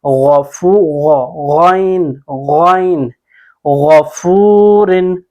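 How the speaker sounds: गफूरिन with a thick, rounded "ghaw" and very long "foo"; slow tajweed chant of गफूरिन; heavy Ghain. Shown as a demonstration. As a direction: repeat गॉइन full-mouthed, stretching गफूरिन. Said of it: "मोटा पढ़ेंगे".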